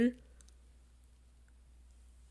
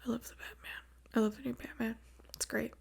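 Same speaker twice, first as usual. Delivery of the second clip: whispering; 3 semitones higher, 8 dB quieter, faster than usual. Real, not acted. Gushing about the new Batman.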